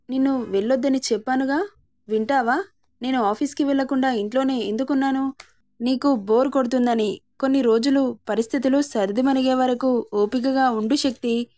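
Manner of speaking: ordinary delivery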